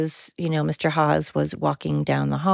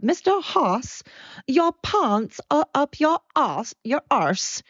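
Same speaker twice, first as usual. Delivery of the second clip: high, slow mock South African accent; slaughtered South African accent; 10 semitones higher, slower than usual. Speaker recalling the student's remark to her teacher, mock South African accent. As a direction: stretch it out in a badly imitated South African accent. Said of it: "I'm going to absolutely slaughter the accent".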